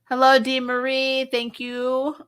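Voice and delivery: ordinary talk, nothing marked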